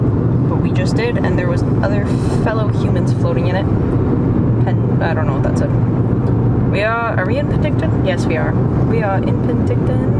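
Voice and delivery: loud upbeat vlog voice; vlog presenter. Acted. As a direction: talk to the viewers. Vlog intro to the camera.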